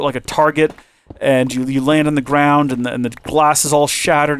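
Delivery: ordinary talk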